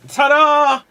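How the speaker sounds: loud, high triumphant ta-da; showy reveal. Acted. Performer showing an organ to distract knifeman, loud mock fanfare. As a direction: present it like a magician's big reveal.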